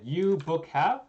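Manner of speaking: "You book have?" lifted high, rising sharply on "have"; question intonation. Shown as a demonstration. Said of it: "say it like it's a question".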